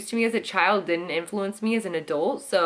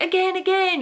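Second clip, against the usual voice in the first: put-on excited kid; 11 semitones higher than usual. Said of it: "we'd be like".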